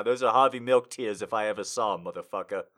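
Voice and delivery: usual speaking voice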